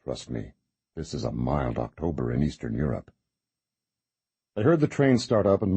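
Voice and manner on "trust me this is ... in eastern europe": whisper. A narrator's reading. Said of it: "he whispered".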